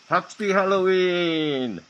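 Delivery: high, slow, loud spooky call; drawn-out haunted-house announcer; spooky Halloween greeting. Pretend.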